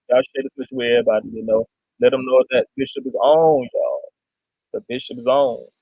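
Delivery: low, droning preacher impression; imitating the bishop. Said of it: "'cause I'm imitating him".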